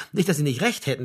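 plain reading voice

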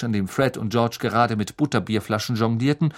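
ordinary delivery

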